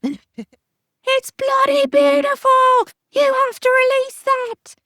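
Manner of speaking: very high, loud, drawn-out Australian shouting; Aussie grandpa voice. Acted. Grandpa raving to granddaughter about her photo, shouted Aussie accent. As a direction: impersonate an excited Australian grandpa yelling down the phone. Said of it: "you gotta do his Aussie voice".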